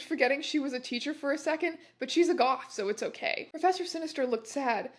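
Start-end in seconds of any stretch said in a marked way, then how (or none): none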